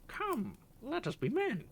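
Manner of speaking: high, loud parrot squawk; squawking parrot cry. Acted. Parrot crying out as the cat pursues the canary. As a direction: screech the catchphrase. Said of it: "flapped his wings and cried".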